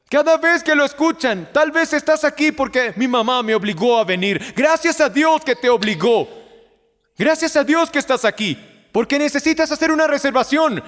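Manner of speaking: high, loud, driving sermon voice; preaching at full force. Acted. Preacher urging the congregation to decide, high and loud. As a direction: press it on the congregation with fire.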